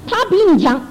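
high, lifted voice of God rebuking gently; voice of the Lord. Retold or imitated. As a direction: speak as the Lord correcting her pride, pitched well up. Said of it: "主说".

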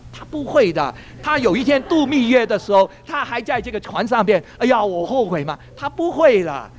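high-pitched, incredulous reasoning; retold talking-to, pitch riding high; exasperated persuasion. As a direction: talk sense into a heartbroken girl. Retells reasoning with heartbroken girl.

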